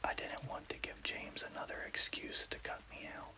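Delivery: plain reading voice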